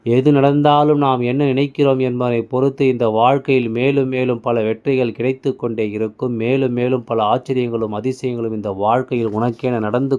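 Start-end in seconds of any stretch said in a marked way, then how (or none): none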